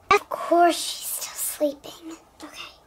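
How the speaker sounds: hushed aside so she won't wake; whisper. Acted. Narrator whispers about sleeping child.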